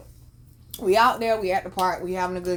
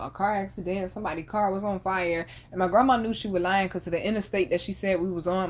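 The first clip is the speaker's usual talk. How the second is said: ordinary talk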